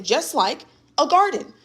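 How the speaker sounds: normal talking voice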